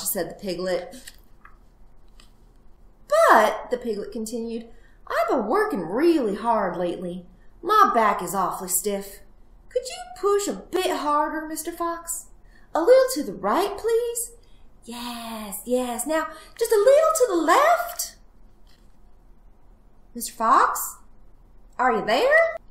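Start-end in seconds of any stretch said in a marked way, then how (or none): 3.1-3.7 high, loud interjection; insistent little piglet; wheedling piglet. Acted. Plays the piglet. 5.1-22.6 high, lilting requests, often loud; cheeky piglet angling for more; demanding piglet. Acted. Plays the piglet.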